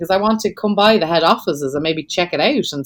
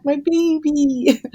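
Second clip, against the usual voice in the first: much higher, slow cooing; baby talk to dog; 9 semitones higher, much slower than usual. Retold or imitated. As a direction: coo over the puppy. Cooing over her dog.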